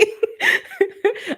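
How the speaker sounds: high tearful laugh through crying; a laugh that breaks into tears; real crying laugh; teary laugh. Real, not acted. Overcome with emotion during reading.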